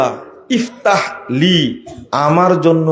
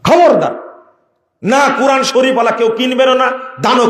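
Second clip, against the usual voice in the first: stern warning; 9 semitones higher than usual. Real, not acted. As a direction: forbid it outright. Preacher forbids buying. (কড়া সতর্কবাণী, উঁচু গলায়)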